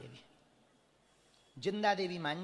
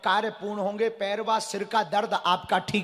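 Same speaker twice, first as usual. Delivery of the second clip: raised proclamation building ever louder; proclaiming the slip; 6 semitones higher, 11 dB louder than usual. Acted. Guru reading a devotee's slip to the crowd, building proclamation. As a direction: read the fortune like a public pronouncement, swelling. Reads the prophecy slip aloud.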